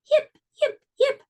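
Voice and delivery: very high, squeaky fox yips; high little fox calling into the night. Acted. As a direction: yelp like a tiny fox calling out. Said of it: "She called".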